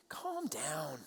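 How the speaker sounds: low, soft, drawn-out hush; condescending calming. Retold or imitated. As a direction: soothe them dismissively. Imitating dismissive reply.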